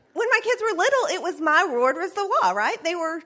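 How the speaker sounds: normal talking voice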